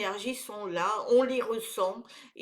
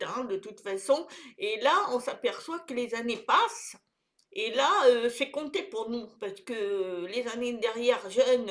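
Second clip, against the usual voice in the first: ordinary talk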